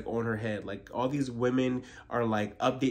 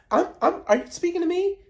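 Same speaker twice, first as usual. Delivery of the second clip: very high, louder snooty question; mock haughty diva; 6 dB louder than usual. Mocking Gizelle snubbing Wendy, high and snooty. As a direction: act offended someone spoke to you.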